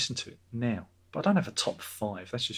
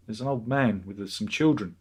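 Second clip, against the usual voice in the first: normal talking voice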